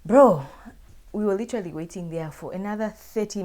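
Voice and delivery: a sudden loud exasperated cry; loud exasperated bro. Real, not acted. Vlogger exclaiming to her viewers, loud exasperated outburst. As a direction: shout bro, pitch jumping high.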